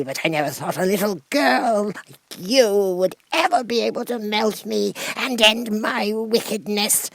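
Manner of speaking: high, crying, fading witch voice; the witch lamenting as she melts; a witch wailing her last words; despairing witch. Acted. Melting witch's last speech.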